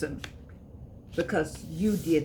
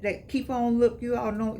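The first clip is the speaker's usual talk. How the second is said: ordinary talk, nothing marked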